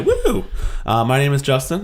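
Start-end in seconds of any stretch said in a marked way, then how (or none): 0.0-0.4 high excited whoop; excited cheer. Real, not acted. Cheering after Spotify.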